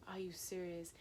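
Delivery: quiet retold reaction; hushed disbelief. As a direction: ask it quietly, under the voice.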